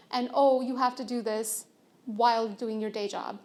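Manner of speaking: ordinary delivery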